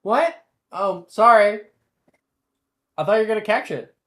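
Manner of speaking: high, loud calling back; loud defensive apology. Acted. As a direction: protest loudly as you apologize. Calls back apology after the splash.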